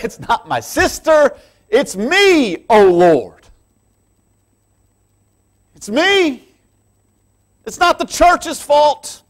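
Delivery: high, loud impassioned preaching; fervent climax of the sermon; preaching at full force. Acted. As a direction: cry it out to the congregation.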